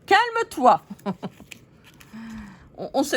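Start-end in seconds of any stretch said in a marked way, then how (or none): 0.1-0.8 much higher and louder calme-toi, exasperated; puppet yelling. Acted. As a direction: shriek calme-toi at the other doll. Puppet repeats, more heated.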